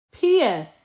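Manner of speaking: ordinary delivery, nothing marked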